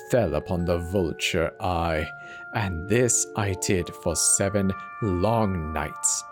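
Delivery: usual speaking voice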